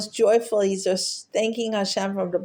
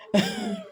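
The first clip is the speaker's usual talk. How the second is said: light laugh. Real, not acted. Laugh after the joke.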